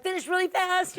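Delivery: high, pressing plea to hurry; retold urgency. Customer rushing her nail tech, retold urgency.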